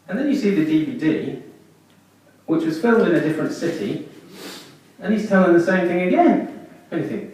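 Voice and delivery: normal talking voice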